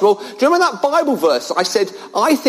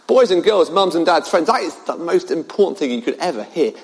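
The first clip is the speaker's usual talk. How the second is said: ordinary talk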